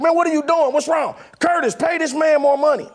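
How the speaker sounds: high, pleading crowd protest; upset crowd. Retold or imitated. The upset club crowd begging the DJ to stay, mock-pleading high.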